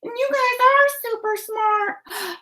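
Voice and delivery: very high squeaky puppet voice; excitable high-pitched helper; Rosa. Acted. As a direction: praise them in a high character voice. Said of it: "Rosa, and so are you".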